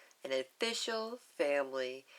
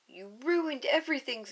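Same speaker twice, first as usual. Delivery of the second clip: put-on angry girl; 7 semitones higher than usual. Lilu accuses Stitch.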